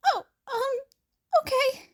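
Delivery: high, small, shaky reply; timid high Izuku. Acted. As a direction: give in meekly, almost crying. Izuku sheepishly agreeing.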